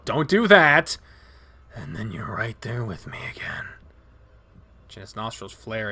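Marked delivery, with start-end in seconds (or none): 0.0-1.0 louder, sharp urgent call; alarmed shout. Acted. Stopping Leo falling asleep. 1.7-3.6 whispering. Acted. Injured wolf drifting off.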